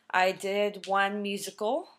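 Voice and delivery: ordinary talk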